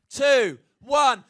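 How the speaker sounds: normal talking voice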